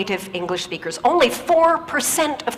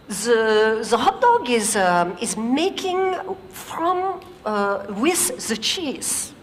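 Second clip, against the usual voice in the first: put-on light French accent, halting and slow; much slower than usual. French engineer trying again to explain, slow halting French accent. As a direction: fumble for words in French-accented English. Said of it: "the French engineer tries again".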